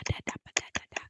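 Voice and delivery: mock ASMR channel intro in a breathy whisper; ASMR YouTuber whisper. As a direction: whisper it very softly and quickly, parodying a YouTuber. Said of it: "a person on YouTube being like".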